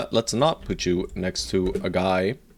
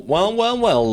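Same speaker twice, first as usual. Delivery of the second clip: mocking taunt; 10 semitones higher than usual. Mocking an enemy in game.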